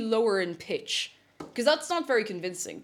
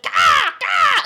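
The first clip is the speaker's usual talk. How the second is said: loud, higher mock caw; fake crow caw; 7 semitones higher, 11 dB louder than usual. Shown as a demonstration. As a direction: bark out a cartoon crow call. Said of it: "that doesn't actually sound like a crow".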